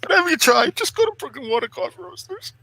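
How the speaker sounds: high, wailing fake sob through the ad; mock crying. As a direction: blubber the pitch like you're overcome. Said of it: "so emotional stuff".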